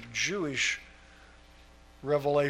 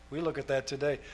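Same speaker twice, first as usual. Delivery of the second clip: normal talking voice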